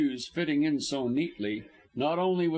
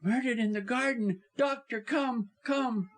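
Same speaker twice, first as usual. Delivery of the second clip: high-pitched pleading wife; distraught, high cry for help; urgent distress; 9 semitones higher than usual. Acted.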